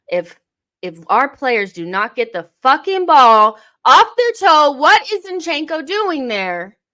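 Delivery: loud, rising angry outburst; angry rant. Real, not acted. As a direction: vent furiously at the players. Angry at sloppy team play.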